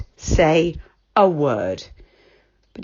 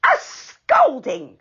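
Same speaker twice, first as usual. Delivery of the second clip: indignant echo; 7 semitones higher, 4 dB louder, much slower than usual. A narrator's reading. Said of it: "said Dame Washalot's voice".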